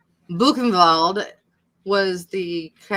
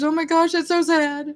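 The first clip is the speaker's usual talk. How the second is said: high-pitched, louder outburst of dismay; 12 semitones higher, 5 dB louder than usual. Real, not acted. Host reacting to sad pictures online, dismayed. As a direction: blurt it out, upset and high. Upset by pictures she stumbled on.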